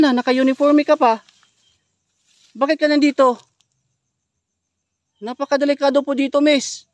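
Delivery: loud calls to a stranger up ahead; calling out. Real, not acted. Calling to a girl in woods.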